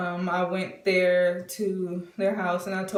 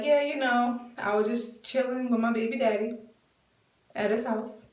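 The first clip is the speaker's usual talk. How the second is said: playfully casual, a little higher; lightly teasing re-enactment; silly; 5 semitones higher than usual. Retold or imitated. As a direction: drop the hint in a silly sing-out. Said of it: "being silly like how she always is".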